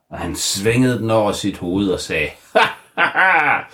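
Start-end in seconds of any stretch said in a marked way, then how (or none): 2.5-3.6 high, loud mock villain laugh; hammed-up wizard ha ha ha; evil laugh. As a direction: cackle in triumph.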